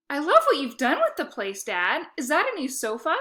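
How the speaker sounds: butter dad up with gushing praise; sweet talk. Acted. Boy gushing over dad's apartment to win him over.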